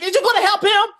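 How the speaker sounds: high, loud demanding shout; desperate yell. Retold or imitated. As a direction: scream at him to help. Kid yelling at cop again.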